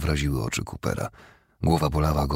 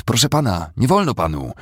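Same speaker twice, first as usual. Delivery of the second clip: higher, louder protest; raised-voice objection; guard intervening; 5 semitones higher, 5 dB louder than usual. A narrator's reading. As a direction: step in and forbid it urgently. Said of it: "zainterweniował strażnik".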